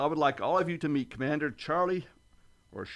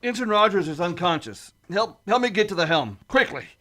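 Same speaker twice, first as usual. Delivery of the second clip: louder, higher, pressing through pain; urgent shout; 3 semitones higher, 8 dB louder than usual. Acted. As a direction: call for help over the noise. Injured captain in crisis.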